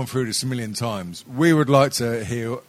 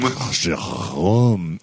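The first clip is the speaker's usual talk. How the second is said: put-on French accent; 4 semitones lower, much slower than usual. Slow French pronunciation.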